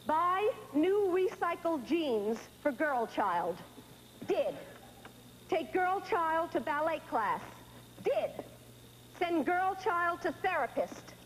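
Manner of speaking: slow, deliberate list reading, item by item. Acted. Mother reads her to-do list to her daughter, slowly and deliberately. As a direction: tick through the chores slowly.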